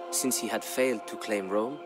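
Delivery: ordinary delivery, nothing marked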